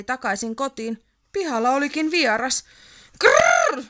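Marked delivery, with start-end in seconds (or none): 3.2-3.8 loud rolled-r growl; troll growl. A narrator's reading. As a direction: growl at the stranger in the yard. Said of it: "se murisi". (kova murina)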